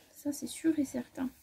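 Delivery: soft voiceless aside; whispered aside. Real, not acted. Sewer confiding her dress plan in a whisper. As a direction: murmur it half to yourself.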